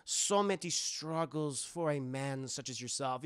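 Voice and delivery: put-on German-accented therapist, slow and probing; softly leading questions in a German accent; Hugo Strange. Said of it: "a very thick German accent".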